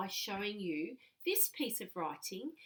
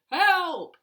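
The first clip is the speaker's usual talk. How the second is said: much louder, higher cry of help; acted yell for help; 8 semitones higher, 15 dB louder than usual. Shown as a demonstration. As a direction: shout it like you're trapped in a cupboard. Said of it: "this is how it would sound".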